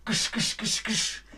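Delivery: whispered gisch hammer sounds; imitated hammering noise. Teacher imitating the hammer nailing theses, whispered. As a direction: hiss out the hammer strikes.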